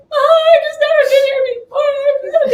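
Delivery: very high, loud, slow sobbing wail; mock bawling. Traveler in tears to customs officer, hammed-up bawling. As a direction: blubber it comically, laughing underneath. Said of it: "I just started bawling".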